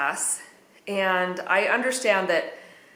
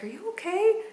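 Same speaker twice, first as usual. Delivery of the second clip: concerned classmate; 12 semitones higher than usual. Retold or imitated. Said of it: "she's like".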